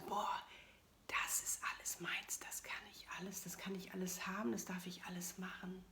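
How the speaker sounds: breathless whisper of wonder; awed whisper. Retold or imitated. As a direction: whisper it like a kid in a toy shop. Retold childlike wonder.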